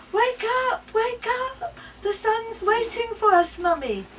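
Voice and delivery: put-on chirpy little girl. A narrator's reading. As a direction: chirp it like an eager small daughter. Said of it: "chirps my daughter".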